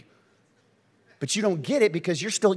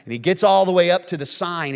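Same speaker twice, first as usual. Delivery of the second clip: ordinary talk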